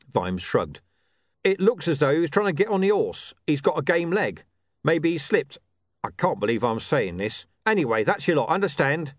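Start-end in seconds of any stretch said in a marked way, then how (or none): 1.4-9.1 lightly voiced, impatient officer; grudging watch commander. Commander grudgingly answers a reporter's question, impatient. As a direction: explain reluctantly, then cut him off. Vimes gives grudging explanation.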